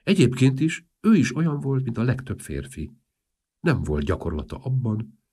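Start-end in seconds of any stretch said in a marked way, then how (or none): none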